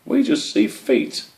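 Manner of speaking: very high, slow little mouse voice; tiny field mouse. Acted. Field mouse tells Squish they only see feet, very high. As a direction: squeak it out slowly.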